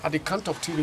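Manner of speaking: ordinary talk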